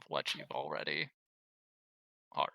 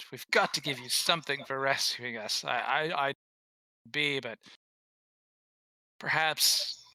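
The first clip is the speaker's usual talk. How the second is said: put-on Scottish dwarf accent; 3 dB louder than usual. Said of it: "really bad Scottish accents".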